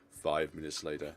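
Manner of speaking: ordinary delivery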